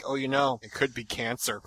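a hint of a pessimistic mother murmuring; gloomy mother. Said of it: "she'll be like".

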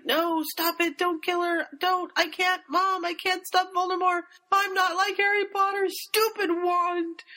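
lightly voiced boy muttering in his sleep; frightened child. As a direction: beg like a scared little boy. Child Al talking in nightmare.

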